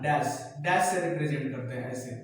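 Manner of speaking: ordinary talk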